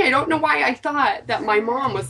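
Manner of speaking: ordinary talk, nothing marked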